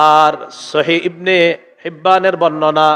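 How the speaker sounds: normal talking voice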